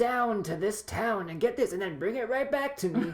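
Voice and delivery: mock a game character handing out an errand; hammed-up, laughing NPC voice; video game NPC. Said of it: "like an N P C in Skyrim".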